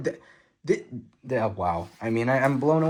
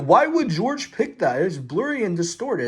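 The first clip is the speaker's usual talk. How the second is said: high-pitched mock complaint; put-on higher voice of a doubter; imitating complaining critics; 6 semitones higher than usual. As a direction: mimic puzzled naysayers, pitched up. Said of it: "people are like".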